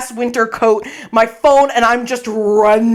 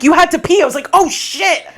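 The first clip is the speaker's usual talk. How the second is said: high-pitched reminder and startled reply; sudden realisation; 7 semitones higher than usual. Acted. Friend reminding her she needed the bathroom, startled. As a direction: jump up in pitch as it dawns.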